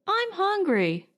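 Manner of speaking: put-on a hungry kid pushing his point; insistent young boy. Billy repeats to his father that he's hungry, high insistent whine.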